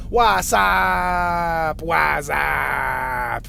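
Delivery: loud shouted What's up, screamed horror-movie style; scary movie shout. Retold or imitated. Riffing on scary movies, loud screamed What's up. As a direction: yell it like the Scream movie phone call. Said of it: "it reminds me of scary movies".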